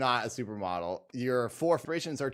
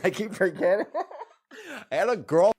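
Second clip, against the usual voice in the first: high, amused, laughing through the words; real laughing; 7 semitones higher, 4 dB louder than usual. Real, not acted. Remembering a forgotten girlfriend, laughing. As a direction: laugh while admitting it, pitch raised.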